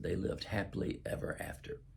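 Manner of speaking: softly whispered closing words; hushed happily ever after; whispered ending. Acted. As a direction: let it fade to a whisper.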